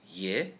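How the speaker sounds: ye rising upward, second tone. Shown as a demonstration. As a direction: lift ye upward.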